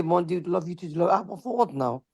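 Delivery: usual speaking voice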